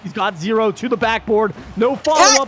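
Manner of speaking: rising excited shout. Real, not acted. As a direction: call it out loud, rising. Goal chance commentary.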